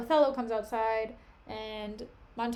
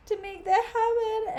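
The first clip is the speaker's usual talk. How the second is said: squeaky apology; 10 semitones higher, 5 dB louder than usual. Retold or imitated. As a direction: pitch jumps high and loud. Cassio apologizing.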